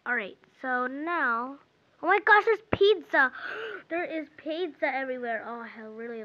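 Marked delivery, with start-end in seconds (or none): 2.0-3.3 high, loud burst of delight; excited discovery. Real, not acted. Player spotting pizza in the game, excited outburst. 3.4-3.8 sharp intake of breath; excited gasp. Real, not acted. Player discovering pizza everywhere, excited gasp.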